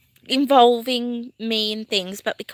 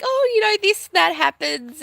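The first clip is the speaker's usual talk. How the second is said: much higher mock voice, imitating herself ranting; 7 semitones higher than usual. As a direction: send up your own rant in a high, put-on voice. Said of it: "if I just go, like".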